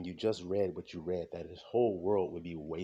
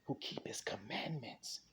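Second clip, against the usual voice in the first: slow, whispered aside; hushed emphasis; 10 dB quieter than usual. Real, not acted. Preacher to listeners on who is targeted, hushed.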